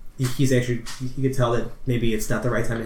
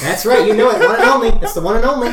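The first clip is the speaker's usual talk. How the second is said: laughing along; 10 semitones higher, 8 dB louder than usual. Real, not acted. Laughing at brunch joke.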